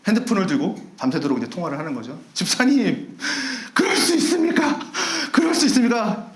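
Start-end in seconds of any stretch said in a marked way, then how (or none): 2.3-6.3 indignant gossip. Retold or imitated. Member complains on phone at night.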